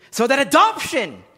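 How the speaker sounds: "adoption" punched high with a pause after it; stress on adoption. Shown as a demonstration. Preacher showing what Paul emphasizes, stress on "adoption". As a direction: drive the phrase up to a strong "adoption". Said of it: "The emphasis on the word adoption".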